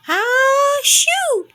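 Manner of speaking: huge high sneeze, loud and sudden; enormous sneeze. A narrator's reading. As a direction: belt out a giant storybook sneeze. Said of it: "let out an enormous".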